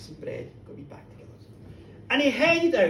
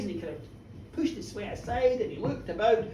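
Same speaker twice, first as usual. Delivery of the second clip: ordinary delivery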